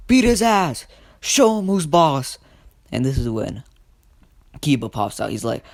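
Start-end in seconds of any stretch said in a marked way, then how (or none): 0.1-2.4 high, loud battle order; rallying shout. Acted. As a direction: rouse the class to attack. Teacher orders students to attack.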